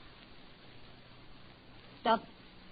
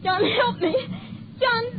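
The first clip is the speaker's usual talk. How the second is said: much higher, crying shout; terrified cry for help; 13 semitones higher than usual. Acted. Wife crying out to husband for help, terrified shout. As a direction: scream for him in terror.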